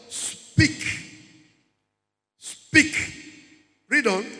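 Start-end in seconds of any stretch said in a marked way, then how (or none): 0.1-0.8 "Speak" with a long hissed s before the burst; "Speak" drawn out for emphasis. Shown as a demonstration. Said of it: "The emphasis there is what". 2.4-3.1 "Speak" with a long hissed s before the burst; "Speak" drawn out for emphasis. Shown as a demonstration. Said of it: "The emphasis there is what".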